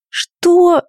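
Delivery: high startled cry; shocked squeak. A narrator's reading. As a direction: blurt it out in shock. Heroine's shocked outcry.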